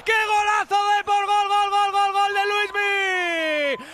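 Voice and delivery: high, loud, euphoric goal call; breakneck goal commentary. Real, not acted. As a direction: shout the goal replay ecstatically. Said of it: "goal celebration commentary".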